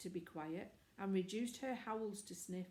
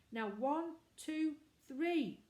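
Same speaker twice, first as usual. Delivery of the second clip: high, louder count; 7 semitones higher, 5 dB louder, slower than usual. A narrator's reading. Boy counting the next yell, calling loud. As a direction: count off again, rising.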